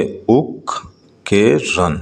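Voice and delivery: اوک کے رن in slow, separated syllables; teacher sounding out اوک کے رن piece by piece. Shown as a demonstration. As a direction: break اوک کے رن into its syllables with a gap after each. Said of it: "Read the following multi-syllable words".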